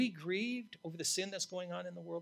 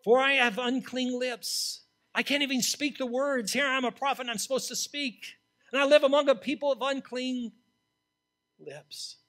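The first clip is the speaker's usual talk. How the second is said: preaching at full force; 8 semitones higher, 12 dB louder than usual. Acted. Preacher voicing Isaiah's confession, preaching at full force. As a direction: cry it out from the pulpit.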